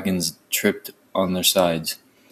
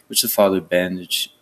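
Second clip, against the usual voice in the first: plain reading voice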